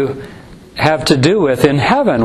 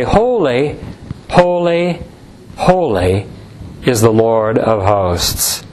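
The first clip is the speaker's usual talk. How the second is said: slow, falling solemn chant of holy; solemn recitation; 4 semitones lower, slower than usual. Acted. Preacher quoting the seraphim to his congregation, solemn and slow. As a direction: intone it like the angels' cry.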